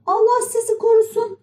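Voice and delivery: very high-pitched little puppet voice; Pinokyo. A narrator's reading. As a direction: ask in a high childlike pitch. Pinokyo tiyatro sahibine sesleniyor. (tiz kukla çocuk sesi)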